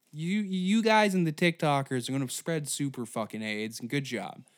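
usual speaking voice

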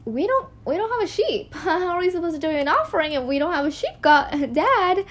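put-on high, laughing nervous teen; acted nervous giggling; nervous son. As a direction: question Dad through uneasy laughter. Teen Isaac nervously questions Dad.

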